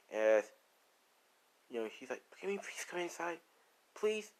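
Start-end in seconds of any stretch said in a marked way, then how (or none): 2.4-4.3 soft, high worried plea. Retold or imitated. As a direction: plead quietly at a raised pitch, imitating a worried woman. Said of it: "she said it with a worried tone".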